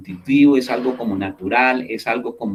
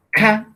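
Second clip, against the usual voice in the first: Ca said alone, high and louder; syllable stressed; 10 semitones higher, 4 dB louder than usual. Shown as a demonstration. Teacher isolating the first syllable of café. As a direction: lift Ca out as its own beat. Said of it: "es el primer conjunto de sonidos".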